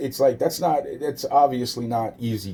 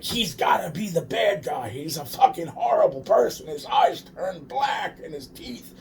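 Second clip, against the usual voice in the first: mocking villain voice; 9 semitones higher than usual. Mocking the spirit world's view of him, high mock spooky voice. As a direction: ham up the spirits' tale of a monster.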